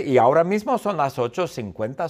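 usual speaking voice

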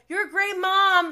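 high, loud mimicked comeback; mock outburst. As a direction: blurt it out like his absurd retort. Mimics his absurd comeback in argument.

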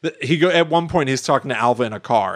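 ordinary delivery

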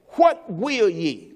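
slow, gentle question. Acted. Jesus asking the blind men, slow. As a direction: ask it slowly, inviting.

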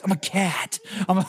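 loose Nicolas Cage voice, laughing; goofy Cage-style delivery; Nicolas Cage impression. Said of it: "then it's Nicolas Cage".

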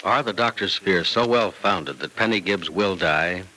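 ordinary talk

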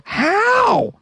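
very high squealed exclamation; drawn-out falsetto bafflement; incredulous. Real, not acted. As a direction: squeal it in comic disbelief. Baffled how horse got upstairs.

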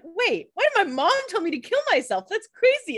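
high-pitched mock disbelief; incredulous. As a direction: imitate someone suddenly baffled. Retold Elena's sudden doubt.

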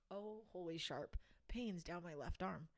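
normal talking voice

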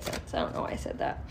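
a quick whispered self-correction; whispered aside. Real, not acted. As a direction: whisper it to yourself, a little embarrassed. Embarrassed aside after odd phrase.

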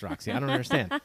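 normal talking voice

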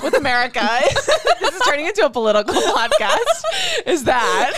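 high, loud talk through laughter; words bursting out through real laughs; laughing. Real, not acted. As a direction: crack up while talking, pitch climbing. Cracking up over political turn.

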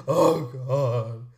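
loud, low mock groan of dismay; mock horrified complainer. Host mimicking horrified complainers, low overblown dismay. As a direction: imitate overdone dismay, deep and drawn out. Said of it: "they actually sounded like that a lot".

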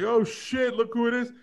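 put-on high, squealed little-kid excitement; reliving it with a high-pitched yelp; excited like a little kid. Host reliving first seeing the video, excited like a kid. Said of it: "I felt like a little kid".

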